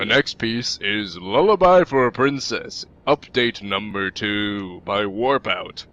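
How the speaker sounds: slow, loud announcer read. Pretend. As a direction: boom it out like a show host. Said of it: "in my announcer voice".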